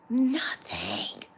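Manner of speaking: hushed, voiceless emphasis; breath-only, dismayed punchline; whispered disbelief. Real, not acted. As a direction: whisper it for dramatic effect. Stressing that nobody offered help.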